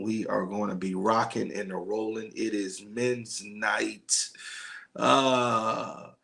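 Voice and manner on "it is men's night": slow, every word weighed, leaning on men's; a slow, deliberate It is men's night; drawn-out announcement. Real, not acted. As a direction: spell out It is men's night slowly for emphasis. Announcing the evening's theme.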